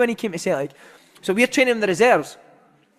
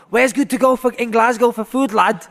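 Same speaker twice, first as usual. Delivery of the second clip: lightly voiced Scouse lad, a touch higher and louder; Scouse accent impression; 4 semitones higher, 3 dB louder than usual. Footballer asks his rival mid-derby about food, mild Scouse accent. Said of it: "He comes up, he's like".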